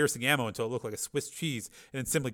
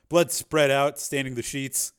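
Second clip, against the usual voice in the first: ordinary delivery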